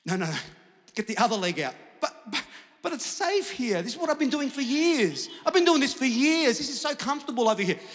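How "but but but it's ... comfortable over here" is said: high-pitched hammed-up protest; mock protesting. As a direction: stammer into a high, loud complaint. Acts out man clinging to boat.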